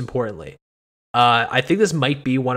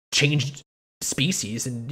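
usual speaking voice

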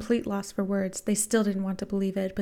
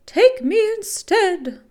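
high, loud, drawn-out plea; desperate scream; 12 semitones higher, 10 dB louder, slower than usual. A narrator's reading. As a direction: scream an offer to save a brother. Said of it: "the woman screamed".

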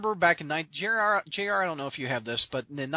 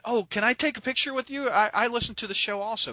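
higher-pitched imitation of an eager fan; 7 semitones higher than usual. Fan asking podcaster for a picture, retold eagerly. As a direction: pipe up like a keen listener.

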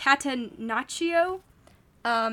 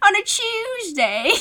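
laughing through a higher mock British accent; 8 semitones higher, 4 dB louder, slower than usual. Echoing her co-host, laughing through a mock British accent. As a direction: echo the joke while cracking up.

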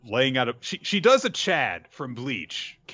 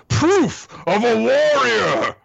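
much higher, loud and slow; booming proclamation; 12 semitones higher, 8 dB louder, slower than usual. Retold or imitated. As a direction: proclaim it like a proud warrior. Werewolf proclaims.

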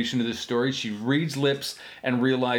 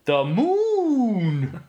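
high, hammed-up announcement; goofy high exclamation; mock exclaim; 9 semitones higher, 4 dB louder than usual. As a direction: blurt it like a big reveal. Mocking alien origin joke.

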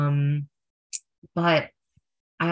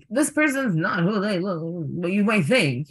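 slow, raised, hammed-up proclamation; mock announcement; 4 semitones higher than usual. Imitating friend's big scene in public, mock-dramatic.

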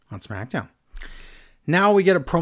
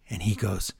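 normal talking voice

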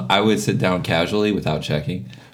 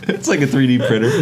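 laughing; 4 semitones higher, 4 dB louder than usual. Real, not acted. Repeating the joke while laughing.

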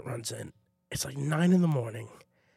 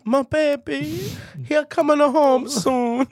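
high, slow, louder mournful mother bird; mother pigeon; 9 semitones higher, 7 dB louder, much slower than usual. Pretend. Mother pigeon waiting in her nest for her baby, high slow lament. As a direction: wail for the lost baby pigeon.

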